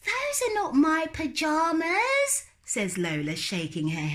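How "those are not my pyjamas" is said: put-on little girl refusing. Lola refusing to go to bed, high and stubborn. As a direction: dig in like a stubborn small child.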